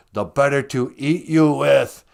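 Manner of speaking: hammed-up storybook villain; menacing wolf. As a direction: pounce on the line, loudly. Wolf's menacing climax in story.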